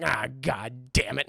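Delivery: low, slow mock grumble; miserable. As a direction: sink into defeated grumbling. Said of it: "And he's miserable".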